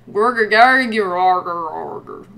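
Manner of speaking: gargle it out like a goblin toad; blurgly monster grumble, sinking away; gurgly toad man. Acted. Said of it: "It's like a gurgly blurgly".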